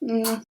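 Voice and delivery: soft air kiss by the cheek; kiss noise. Shown as a demonstration. Teacher shows the cheek kiss, a soft smack. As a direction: make the little kiss sound. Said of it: "the little noise".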